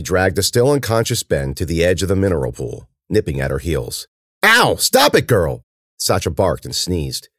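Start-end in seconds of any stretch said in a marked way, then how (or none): 4.4-5.6 high, loud yelp and scold; yelp, then scold the dog; pained scolding. Acted. Cece nipped by her dog, yelping reprimand.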